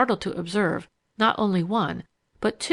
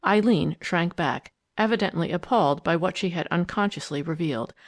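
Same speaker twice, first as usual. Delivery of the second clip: ordinary delivery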